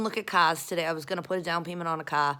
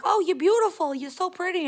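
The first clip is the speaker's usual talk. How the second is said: high-pitched imitation of admirers; mock gushing; 12 semitones higher, 6 dB louder than usual. People tell her she's beautiful, mock high gushing. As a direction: gush it high, mocking the compliment.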